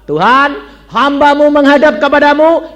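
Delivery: loud, high formal address to God; retold prayer. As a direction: present yourself to God at full voice. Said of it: "Saya berdoa".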